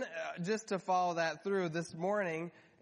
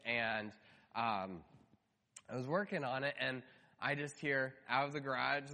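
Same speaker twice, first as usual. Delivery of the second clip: ordinary talk, nothing marked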